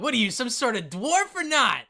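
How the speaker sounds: loud, high, mock-outraged challenge; goading dwarf. Dwarves look at Wilhelm refusing drink, loud goading. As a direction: goad a pal into drinking.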